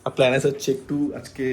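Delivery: ordinary talk, nothing marked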